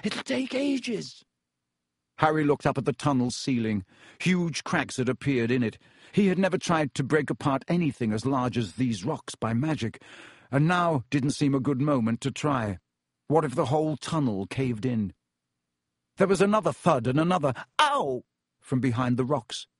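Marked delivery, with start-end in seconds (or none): 0.0-1.2 high, slow, strained wail; despairing cry from behind a wall; desperate. A narrator's reading. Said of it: "sounding desperate". 17.8-18.2 high, sudden "ow"; yelp of pain. A narrator's reading. Second loud yelp of pain.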